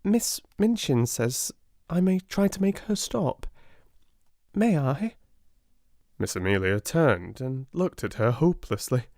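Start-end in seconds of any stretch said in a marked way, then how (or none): 0.0-5.2 Sarah asking. A narrator's reading. Sarah asks quietly.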